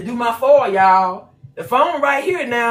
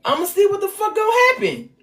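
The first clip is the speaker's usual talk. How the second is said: much higher, daring them to come; defiant challenge; 8 semitones higher than usual. Real, not acted. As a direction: taunt them, fearless.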